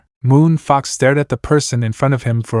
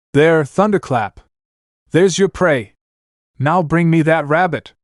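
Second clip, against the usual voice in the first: raised, commanding battle shout; shouting commands; 5 semitones higher than usual. Acted. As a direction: call out the target with force. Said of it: "she shouted".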